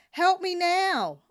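slow, very loud high bellow, HELP hit hard. Retold or imitated. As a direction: bellow it like a final warning. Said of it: "I bellowed".

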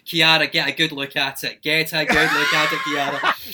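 loud, insistent call to look; retold shouted urging. Naked model to student avoiding eye contact, retold loud urging. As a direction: shout it as a mock order to Kiara.

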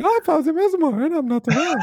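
really high-pitched mock impression; exaggerated high voice imitating an absent colleague; Rami Ismail. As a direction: squeak it out as a silly impersonation. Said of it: "really high pitch".

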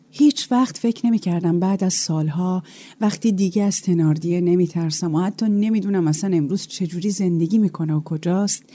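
ordinary delivery, nothing marked

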